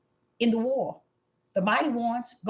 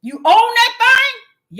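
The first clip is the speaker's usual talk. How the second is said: You own that thing, slow, much higher and louder; preached shout; 11 semitones higher, 10 dB louder, much slower than usual. Shown as a demonstration. Preacher urging the sick, slow loud cry. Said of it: "I'ma tell y'all the truth".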